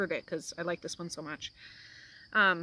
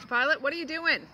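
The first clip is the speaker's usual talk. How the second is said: calling out; 7 semitones higher, 5 dB louder than usual. Real, not acted. Calling out to a child.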